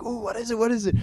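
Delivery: eager, high and curious; gleeful little ooh, what is it; excited. Retold or imitated. Excited about a new item.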